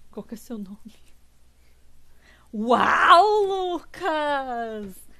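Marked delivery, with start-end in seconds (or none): 2.5-5.1 loud, high delighted shout with a laugh; excited greeting. Real, not acted. Streamer recognising a viewer, loud excited whoop. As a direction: burst out in happy recognition.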